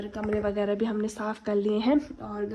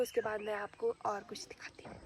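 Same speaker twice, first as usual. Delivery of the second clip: whispered aside; 8 dB quieter than usual. Real, not acted. Teasing what comes next in vlog.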